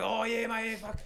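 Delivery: high-pitched imitation of rambling street man; homeless man's voice. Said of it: "that's how he speaks".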